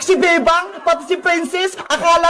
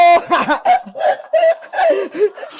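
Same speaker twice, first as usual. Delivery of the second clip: higher-pitched gleeful laughing; 4 semitones higher than usual. Acted. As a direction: laugh long and high over the takings. Vendor gleeful after making money.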